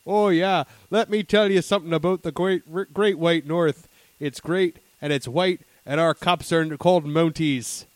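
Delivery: hearty Canadian moose spiel, drawn out at the end; Monty the Moose. Acted. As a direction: play a proud Canadian mascot. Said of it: "Monty the Moose up there talking about it".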